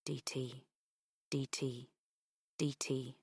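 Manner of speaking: very soft whispered rhythm. A narrator's reading. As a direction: hush it like a ticking click. Whispered clicking thought.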